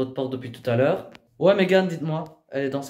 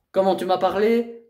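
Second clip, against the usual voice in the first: ordinary delivery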